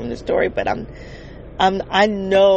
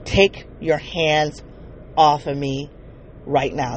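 low and slow, each word spaced out; slow, deliberate stern warning; calm but iron-firm demand; stern order; 5 semitones lower, slower than usual. Retold or imitated. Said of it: "talking to her in this very stern word".

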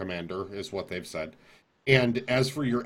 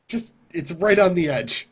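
excited laughing; 9 semitones higher, 10 dB louder than usual. Real, not acted. Laughing over a close call.

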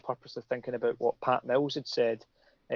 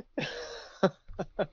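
high, wheezing laughing fit; helpless laughter; 7 semitones higher, 6 dB quieter than usual. Real, not acted. Guest broken by the silly advert, helpless laughter. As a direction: crack up completely and keep laughing.

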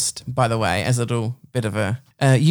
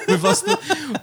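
laughing; 4 dB quieter, much slower than usual. Real, not acted. Cracking up mid-sentence.

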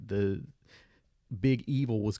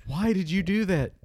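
shocked question; 8 semitones higher, 6 dB louder than usual. Retold or imitated. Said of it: "And it's like".

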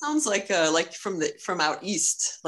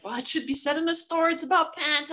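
excited; 9 semitones higher than usual. Retold or imitated. Retells partner pitching the new idea.

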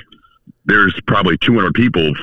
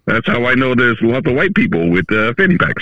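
put-on Russian accent; 5 semitones higher than usual. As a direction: play it as a Russian speaker. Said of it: "I didn't know you could do Russian accents".

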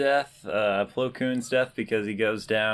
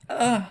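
short high "ugh"; mock high-pitched grunt of collapse; imitated death groan; 12 semitones higher than usual. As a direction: mimic a character flopping over. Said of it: "She's kinda like".

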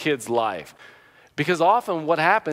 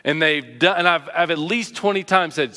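ordinary talk

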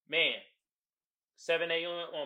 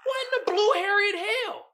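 much higher and louder, squealing mock outrage; outraged disbelief; 7 dB louder than usual. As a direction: shriek it like you can't believe this song. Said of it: "I was like".

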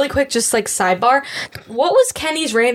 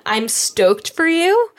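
mock enthusiasm; 7 semitones higher, much slower than usual. Real, not acted. Teasing about volleyball without support.

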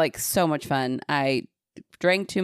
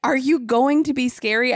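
mocking taunt; 8 semitones higher, 4 dB louder than usual. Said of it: "I was like".

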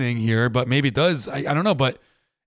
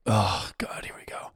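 whispered groan; 6 semitones lower, 8 dB quieter, slower than usual. Retold or imitated. Dreading the where-are-you-from question, whispered. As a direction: whisper it with a weary sigh. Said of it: "I'm just like".

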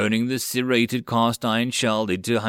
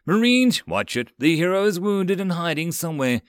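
yelled warning; 6 semitones higher than usual. A narrator's reading. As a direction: call the squad to attention. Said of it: "Teria yelled".

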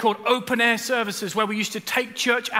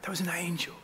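whispered echo; 4 semitones lower, 11 dB quieter than usual. Shown as a demonstration. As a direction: whisper "That was an angel" softly, unvoiced. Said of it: "I remember God saying".

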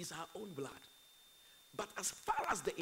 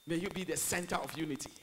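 usual speaking voice

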